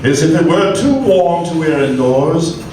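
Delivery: normal talking voice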